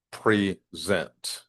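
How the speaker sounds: "present" split slowly into pre - ZENT; syllable-by-syllable stress. Shown as a demonstration. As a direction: break "present" in two, weight on the second half. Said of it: "word stress".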